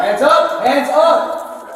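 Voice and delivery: loud, high barked order; shouted command. Real, not acted. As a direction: shout it to force surrender. Officer commanding suspect to surrender.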